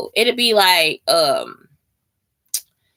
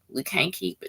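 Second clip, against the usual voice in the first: fading, much softer repeat; 4 semitones lower, 11 dB quieter than usual. Real, not acted. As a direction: let it fade almost to nothing.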